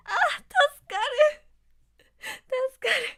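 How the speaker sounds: very high, loud swooning cry; fangirl squeal. Acted. Fan hearing the boy voice, squealing. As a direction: squeal as if saved. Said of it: "every time I'm like".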